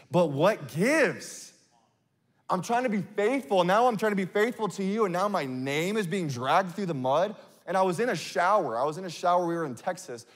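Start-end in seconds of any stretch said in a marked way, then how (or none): 0.1-7.4 higher-pitched, mildly hammed-up protest; exasperated pleading. Preacher retelling his complaint to God, exasperated. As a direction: plead your case, baffled and put out.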